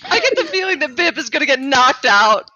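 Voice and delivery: high shout through laughter; laughing shout. Real, not acted. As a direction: shriek it while cracking up. Party erupts at bad roll.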